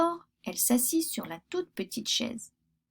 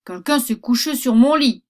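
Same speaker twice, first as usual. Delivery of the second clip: very loud booming papa bear; a big bear bellowing about his bed; big bear's gruff voice; 2 semitones higher, 9 dB louder than usual. Acted. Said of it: "dit le grand gros ours de sa grosse voix".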